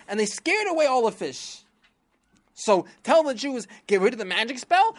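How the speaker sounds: high, loud scheming minister; high eager villain blaming others. Acted. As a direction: rush to the king with gleeful news.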